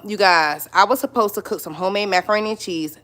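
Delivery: normal talking voice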